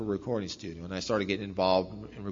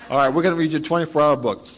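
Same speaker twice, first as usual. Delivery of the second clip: mock AA members; 4 semitones higher, 8 dB louder than usual. Mimicking AA members' insistence.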